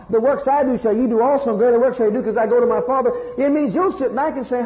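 high, loud, driving sermon voice; impassioned preaching. Acted. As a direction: preach it with full fire, pushing each line. Rising sermon climax.

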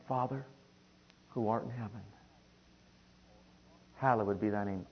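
soft, tender prayer; child approaching father. Shown as a demonstration. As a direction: say it gently and personally, in small phrases. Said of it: "as a child approaching a father".

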